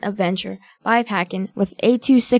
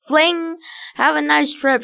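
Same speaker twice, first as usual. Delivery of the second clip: higher-pitched mild shout; shouted send-off; 6 semitones higher than usual. A narrator's reading. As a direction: call it out like a gleeful villain. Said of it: "shouted Evil King Carl".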